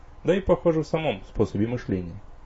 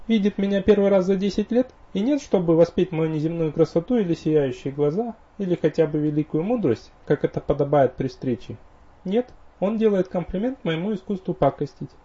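mock offended; 8 semitones higher, 4 dB louder than usual. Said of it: "притворно обиделась эльфийка".

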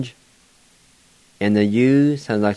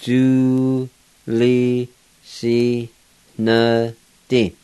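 "Du, li, si, ne, di" as five held syllables; slow breakdown with a full "li"; syllable-by-syllable pronunciation; much slower than usual. Shown as a demonstration. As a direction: sound out each syllable with a pause, "li" fully voiced. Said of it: "pronounce these words".

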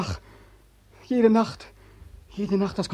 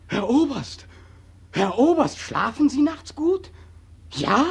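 bitter taunt; 6 semitones higher, slower than usual. Acted.

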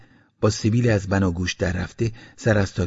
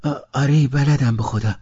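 a boy's stammering, unsure yes, voiced higher; nervous boy swearing he can do the work; hesitant; 5 semitones higher, 4 dB louder than usual. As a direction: falter on the first sound, then push the oath out. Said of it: "با دودلی گفتم".

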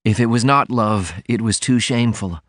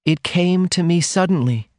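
higher heroine voice, gently measured; slow, tender confession; 9 semitones higher than usual. A narrator's reading. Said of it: "She was speaking very slowly".

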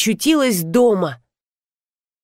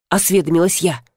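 ordinary delivery

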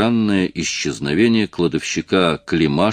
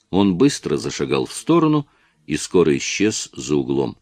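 ordinary delivery, nothing marked

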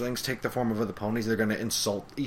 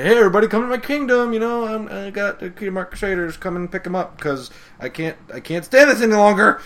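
much higher and louder, fast; Chrysalis calling out; 9 semitones higher, 7 dB louder than usual. Retold or imitated. Chrysalis begging ponies to collect the Crusaders, loud high call. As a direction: holler an exasperated invitation. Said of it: "she's just let everybody".

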